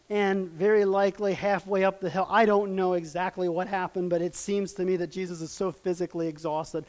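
normal talking voice

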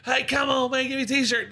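high-pitched pestering heckler; mock yelling. Fan nagging for a t-shirt, high mock yell. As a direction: imitate an insistent fan calling out. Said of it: "screaming and yelling at me".

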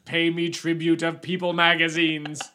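loud slow royal demand; roaring tyrant king. Pretend. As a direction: command tribute like a tyrant. Playing neighborhood king.

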